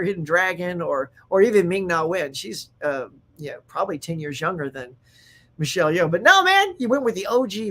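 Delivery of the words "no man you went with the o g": excited approval. Real, not acted. Praising casting Michelle Yeoh.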